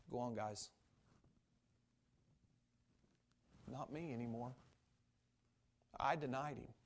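low, subdued resignation, with long pauses; heartbroken. Acted. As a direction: speak as a dejected Peter who counts himself out. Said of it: "Peter was sitting there heartbroken".

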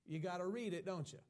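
usual speaking voice

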